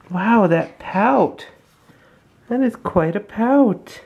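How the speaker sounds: drawn-out, amused awe; slow marvel. Real, not acted. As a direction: stretch it out in awe. Marvelling at baby's pout.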